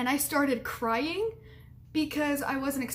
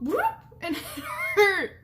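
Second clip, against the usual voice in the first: laughing; 8 semitones higher, 3 dB louder than usual. Real, not acted. Retelling painful first time.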